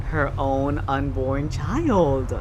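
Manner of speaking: usual speaking voice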